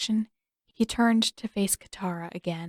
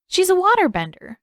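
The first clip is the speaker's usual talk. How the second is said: put-on high, louder eager boy; eager little boy; 11 semitones higher, 7 dB louder than usual. A narrator's reading. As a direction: share the big news. Boy telling mother.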